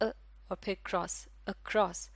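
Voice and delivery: अ then क्रॉस, then across blended; word split into sounds. Shown as a demonstration. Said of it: "यही आवाज़ है across".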